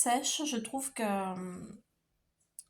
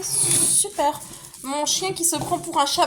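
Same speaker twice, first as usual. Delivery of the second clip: exasperated; 6 semitones higher, 7 dB louder than usual. Real, not acted. Dog acting up, owner exclaims.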